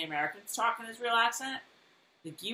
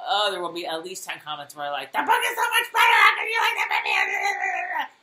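mocking commenter; 6 dB louder than usual. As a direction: squeal it like an outraged book snob. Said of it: "people will be like".

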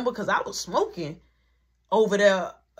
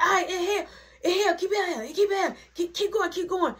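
friends cheering; 11 semitones higher, faster than usual. Retold or imitated. Friends coaching his inhale, high laughing chant. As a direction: coax him to keep inhaling. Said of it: "They like".

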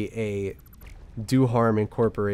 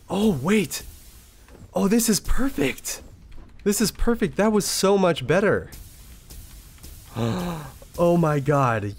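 excited; 6 semitones higher than usual. Real, not acted. Thrilled the build works.

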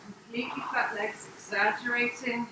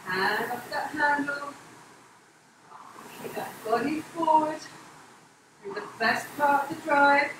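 coach calling out; 4 semitones higher, 4 dB louder than usual. Real, not acted. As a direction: push the voice out over the rowing noise. Coach calling out during rowing.

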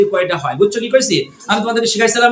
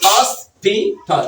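very slow "hospital" in separate syllables; teacher's slow syllable demo; much slower than usual. Shown as a demonstration. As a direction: draw out each part of "hospital". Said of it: "কয়টা syllable?".

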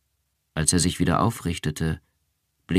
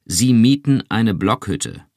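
slow and firm, leaning on each word; a firm, insistent interrogator; with emphasis; 4 semitones higher, 5 dB louder, slower than usual. A narrator's reading. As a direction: lay it down deliberately. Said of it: "sagte er mit Nachdruck".

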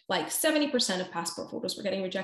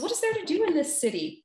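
rapper's question; 10 semitones higher, 3 dB louder than usual. Retold or imitated. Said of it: "And he goes".

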